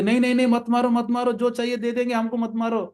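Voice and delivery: high-pitched, hurried mock begging; acted frightened pleading. Lord, posing as merchant, begs the robber not to hit, acted fear. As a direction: plead for mercy in pretend terror. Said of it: "तो भगवान तो कांपने लगे".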